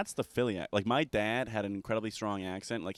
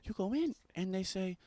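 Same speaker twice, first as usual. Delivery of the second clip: higher, softer voice in a put-on Mexican accent; Mexican accent impression; 9 semitones higher, 4 dB quieter than usual. Retelling a Mexican coworker's massage parlor pitch, in a put-on Mexican accent. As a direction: quote the coworker's broken English pitch, higher than your own. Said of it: "He goes, he goes".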